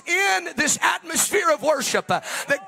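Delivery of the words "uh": normal talking voice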